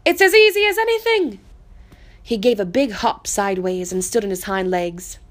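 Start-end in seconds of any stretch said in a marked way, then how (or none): 0.0-1.4 high, loud, cocky wild rabbit voice; boasting wild rabbit. Acted. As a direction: brag that it's nothing at all. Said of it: "said a furry rabbit".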